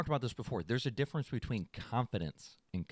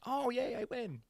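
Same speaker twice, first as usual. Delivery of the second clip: mock-cheery gloat; 10 semitones higher, 5 dB quieter than usual. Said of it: "I was like".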